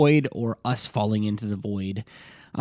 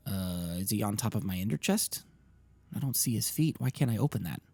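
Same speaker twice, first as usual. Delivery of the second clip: hushed; 2 semitones lower, 9 dB quieter than usual. Real, not acted. Sneaking near an Enderman.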